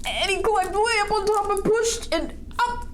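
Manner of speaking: high-pitched build-up to the big push; excited storytelling. A narrator's reading. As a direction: narrate the climb in a high, suspenseful voice. Narrating the squirrel's big push.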